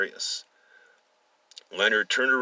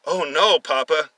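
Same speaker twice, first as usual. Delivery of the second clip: high, louder mock innocence; teasing reply; 7 semitones higher, 7 dB louder than usual. A narrator's reading. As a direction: play innocent to your angry father. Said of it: "and then chuckled afterwards".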